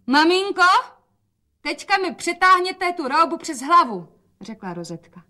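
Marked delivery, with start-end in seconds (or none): 0.1-4.1 much higher, louder, cheerful call; bright calling out. A narrator's reading. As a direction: call out loud for father to hear. Dcera hraje veselost pro otce. (hlasité veselé volání)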